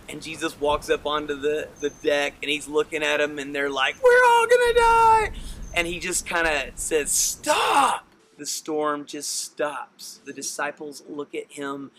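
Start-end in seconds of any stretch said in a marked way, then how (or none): 4.0-5.3 very high, loud hammed-up terror; panicked scream. Disciples to Jesus on the flooding deck, panicked scream. 7.1-8.1 sudden high, loud command; commanding shout. Acted. Jesus orders the storm to be still, commanding shout.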